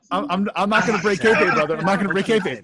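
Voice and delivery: mock wrestler promo. Joking refusal, in wrestler character.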